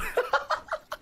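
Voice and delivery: high, helpless giggle; real laughter. Real, not acted. Storyteller cracks up at the rope part, high laughter. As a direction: burst out laughing mid-story.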